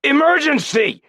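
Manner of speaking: very high, loud shout; very high, loud shout for help. A narrator's reading. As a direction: wave them over with a yell. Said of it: "Jed shouted".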